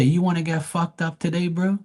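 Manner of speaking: threat. Retold or imitated. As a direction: menace him with the bat. Speaker threatens with bat.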